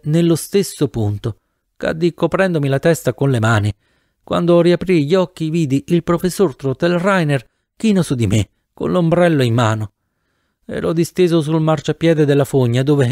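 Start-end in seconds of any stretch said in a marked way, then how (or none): none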